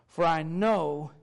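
"know" slowed and held; slow right down to weight "know"; stress on know. Real, not acted. Preacher has listeners underline the word, slow stress on "know". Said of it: "Underline that word, "know".

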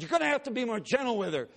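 put-on a father snapping at the doctor to go easy on his burned girl; angry demand.

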